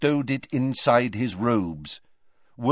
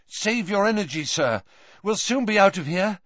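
higher, pressing; worried urging; 8 semitones higher than usual. A narrator's reading. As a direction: coax him onward. Harry urging weakened Dumbledore on.

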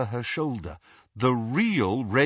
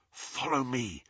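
soft, hushed instruction; whispering; 7 dB quieter than usual. A narrator's reading. Harry to the terrified group, whispering. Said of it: "he whispered to the group".

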